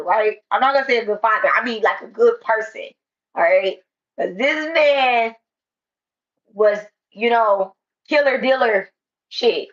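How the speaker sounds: loud, slow preaching to a crowd. Acted. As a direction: preach it out at full force. Preaching loudly to an audience.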